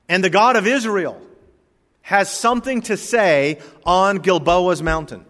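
loud, fervent preaching. Acted. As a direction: proclaim it from the pulpit. Preaching at full force.

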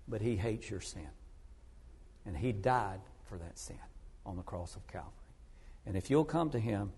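tender earnest plea. Retold or imitated. Preacher pleads with the lady beside him on the plane, tenderly. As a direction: gently press each phrase home, hushed toward the end. Retold gospel plea to seatmate.

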